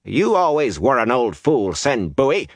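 scornful, high-pitched put-down; contemptuous woman scolding; snorted contempt. A narrator's reading. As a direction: dismiss the old fool with scorn. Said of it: "Days snorted".

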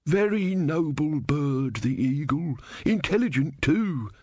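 put-on slow droning old bore; elderly man droning. A narrator's reading. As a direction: pontificate slowly. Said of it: "said the elderly man".

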